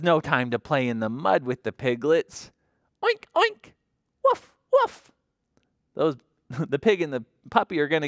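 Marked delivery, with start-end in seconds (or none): 3.0-3.7 very high playful oinking; pig oink. A narrator's reading. The pig plays in the mud with Biscuit, high oinks. 4.2-5.1 very high little woofs; puppy bark. A narrator's reading. Biscuit barks at the baby chicks, high yapping woofs.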